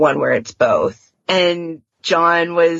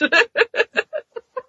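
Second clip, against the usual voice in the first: short, very high real laugh; high giggle. Real, not acted. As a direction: let a giggle escape. Laughs at own retelling.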